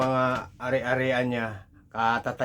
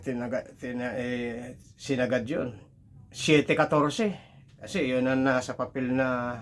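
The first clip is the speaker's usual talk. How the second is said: ordinary talk, nothing marked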